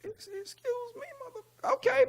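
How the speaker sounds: very high, squeaky offended falsetto; mock offended. Rapper retelling his reaction to the producer, high mock-offended squeak. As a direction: play the shocked, insulted guy in falsetto.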